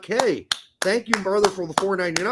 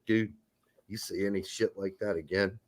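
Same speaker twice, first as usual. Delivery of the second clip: menacing low undertone, much softer; low, quiet threat; 10 semitones lower, 8 dB quieter than usual. Retold or imitated. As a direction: talk low so only the child hears. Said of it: "is to talk so low that nobody else around you can hear you".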